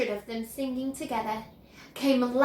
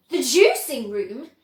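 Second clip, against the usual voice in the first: louder alarmed question; alarmed cry; 7 dB louder, slower than usual. A narrator's reading. Mother aghast at Wonka's plan, loud cry. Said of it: "cried Missus Beauregard".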